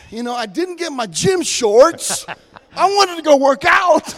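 high, loud, mock-exasperated outburst; mock protest. Telling the congregation he skipped the gym, in high loud mock exasperation. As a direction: throw up your hands over the gym shorts. Mock complaint about missing shorts.

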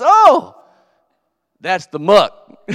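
high, loud, then slowed right down; mock shock. Preacher acting his own shock at the club. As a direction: gasp then drag out the words. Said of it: "I didn't freak out".

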